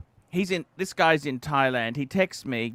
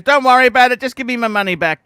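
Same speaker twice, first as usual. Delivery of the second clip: louder, higher mock demand for a refund; angry customer; 6 semitones higher, 7 dB louder, faster than usual. Retelling the customer's text in a mock angry voice. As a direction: bark it like an irate buyer wanting cash back. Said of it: "He goes".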